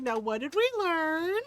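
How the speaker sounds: high singsong teacher question; mock lecturing. Teasing kid caught by mom, singsong lecturing.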